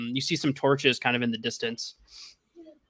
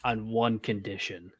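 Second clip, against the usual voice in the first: low, slow, hushed ultimatum; very dramatic; 5 semitones lower, 8 dB quieter, slower than usual. Acted. Hooded stranger names his terms, very dramatic. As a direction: drop low and draw it out dramatically. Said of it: "very dramatically and goes".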